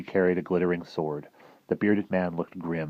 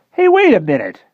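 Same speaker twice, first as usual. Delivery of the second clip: much higher, louder blurt; sudden interruption; 12 dB louder than usual. Acted. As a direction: cut in suddenly. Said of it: "said Fergie suddenly".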